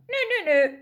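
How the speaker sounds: very high squawked denial; seagull. A narrator's reading. Seagull denies any trap, high squawk. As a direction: chirp it like a cheeky gull. Seagull voiced in read story.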